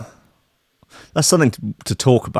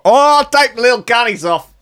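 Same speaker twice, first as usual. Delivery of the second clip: mock Australian; 13 semitones higher than usual. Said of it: "Paulie's, um, Australian".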